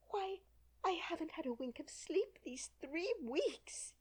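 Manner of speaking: fretful pigeon. A narrator's reading. Pigeon character voice.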